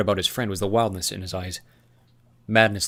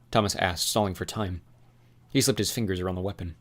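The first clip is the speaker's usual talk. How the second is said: ordinary delivery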